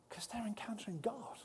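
hushed whisper full of awe; awed whisper. Acted. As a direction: breathe it out in a whisper. Awed aside after the falling crowd.

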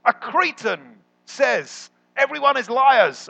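high, loud, slow mock-dramatic reveal; mock punchline. Preacher spelling out Paul's joke to the congregation, hammed up. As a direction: land the joke with exaggerated emphasis. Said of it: "what Paul says is".

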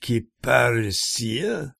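Kiparissia? in slow separate syllables, as a question; staccato. Retold or imitated. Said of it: "He repeated the name syllable by syllable".

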